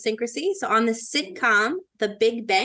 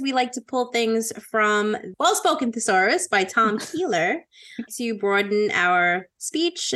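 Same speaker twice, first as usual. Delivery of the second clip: usual speaking voice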